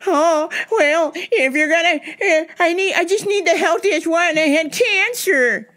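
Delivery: high, pleading put-on lady voice; customer lady voice.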